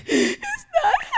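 put-on high-pitched child's wail; tearful squeal, acted out; mock crying. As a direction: imitate a little girl crying in frustration. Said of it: "she started to cry".

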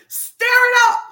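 high, loud, strained preacher cry; roaring call to stir it up; full shout. Real, not acted. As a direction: blast it out to rouse the room.

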